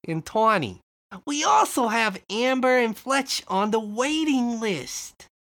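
high, wary shopkeeper voice; hesitant shopkeeper. Acted.

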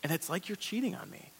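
a quiet wounded aside from God; God grieved. Acted. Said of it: "God says".

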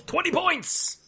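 very high celebratory cry; playful yell. Acted. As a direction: call out a score in a high voice. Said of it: "DeSoto yells".

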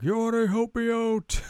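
squeaky ask for help; another villager. Retold or imitated. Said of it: "this other guy's like".

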